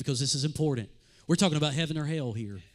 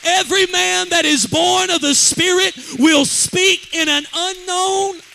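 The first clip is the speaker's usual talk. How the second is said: scripture quote shouted high and loud, slow; 13 semitones higher, 12 dB louder, slower than usual. Acted. Preacher quoting Jesus to the church, shouting slowly. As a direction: cry the words of Jesus out to the whole church. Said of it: "And Jesus said".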